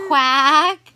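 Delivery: loud, drawn-out quack; duck quack. Acted. Parent showing what duck says, loud quack. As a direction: quack it for a toddler. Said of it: "Duck says".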